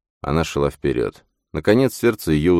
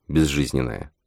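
ordinary delivery, nothing marked

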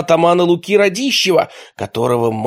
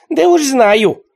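high, loud cocky retort; smug; 12 semitones higher, 6 dB louder, much slower than usual. A narrator's reading. Nichevok boasting to Luka, smug. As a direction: puff up proud and boast. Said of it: "приосанился Ничевок".

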